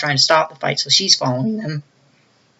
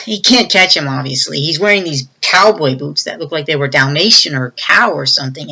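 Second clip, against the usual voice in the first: ordinary talk, nothing marked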